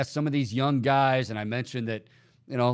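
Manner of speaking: ordinary delivery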